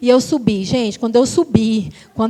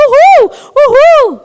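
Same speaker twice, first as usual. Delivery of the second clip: high, loud whooping "uhul"; joyful whoop; 12 semitones higher, 8 dB louder, much slower than usual. Retold or imitated. Mother cheering through the turbulence, high loud joyful whoops. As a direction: whoop with glee like on a ride. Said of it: "gritar de alegria".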